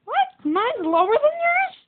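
high, shocked disbelief. Real, not acted. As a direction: squeak it out in disbelief. Shocked at own lower health.

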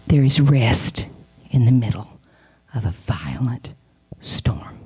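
low, measured, with pauses; slow and deep, phrase by phrase; solemn pronouncement. Retold or imitated. Solemn pronouncement of God's message.

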